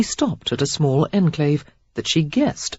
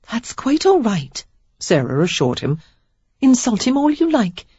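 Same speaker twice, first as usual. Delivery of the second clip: put-on high young woman voice; 6 semitones higher than usual. A narrator's reading. Sarah voiced in dialogue.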